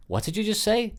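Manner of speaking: can't believe she just joked; startled disbelief. Retold or imitated. Reacting to her joke.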